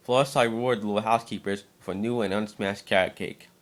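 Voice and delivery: ordinary delivery, nothing marked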